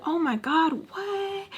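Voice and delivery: high mock-amazed voice, softening at the end; imitating her amazed reaction. Imitating her as she starts laughing, high amazed voice. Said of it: "And she starts laughing".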